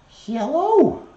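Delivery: very high, bright parrot hello; squeaky bird hello. Pretend. Speaking for the parrot to viewers, high squeaky bird hello. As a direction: chirp it like a parrot.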